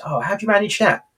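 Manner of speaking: put-on high, amazed old man's question; Grandad voice. Grandad marvelling at Del Boy's sales, in a high voice.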